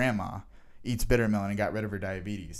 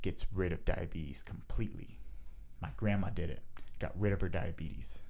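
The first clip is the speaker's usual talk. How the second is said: hushed; 4 semitones lower, 7 dB quieter than usual. Retold or imitated. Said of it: "He kept saying bitter melon".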